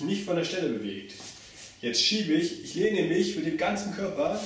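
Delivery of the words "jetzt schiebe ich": ordinary talk, nothing marked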